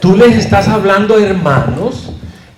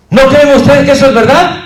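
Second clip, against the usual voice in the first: loud, high, quick rhetorical question; preacher's question; 8 semitones higher, 8 dB louder, faster than usual. Shown as a demonstration. Teacher demonstrating a question before a one-second pause, loud preaching. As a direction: challenge the congregation from the pulpit. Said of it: "O un segundo".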